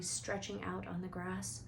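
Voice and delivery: ordinary delivery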